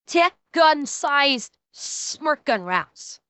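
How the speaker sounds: normal talking voice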